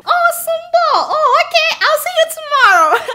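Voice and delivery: high, bright put-on girly voice with a laugh; high-pitched giggly girl voice; mock sweet campus girl. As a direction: play the fake-friendly floater saying goodbye. Mimicking a floater girl on campus.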